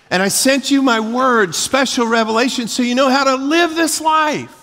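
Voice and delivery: high, loud, impassioned preaching; yelling preacher. Real, not acted. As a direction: preach it at a yell, pitch pushed right up. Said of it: "I'm sorry, am I yelling?".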